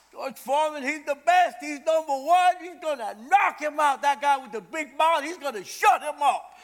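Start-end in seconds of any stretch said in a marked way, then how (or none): none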